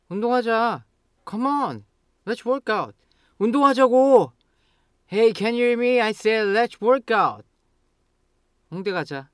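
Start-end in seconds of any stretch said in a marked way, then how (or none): 0.1-2.9 high-pitched coaxing; urging. Shown as a demonstration. Nagging a friend to work out, urging. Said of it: "just changing the pitch". 3.4-7.4 high, loud, exasperated shout; angry. Shown as a demonstration. To a friend who won't listen, angry. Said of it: "Then you get angry and say".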